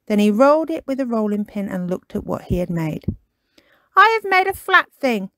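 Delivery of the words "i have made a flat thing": higher, louder puppy voice, let down; a small dog staring at his flat cake; Kipper, dismayed. Acted. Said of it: "he said".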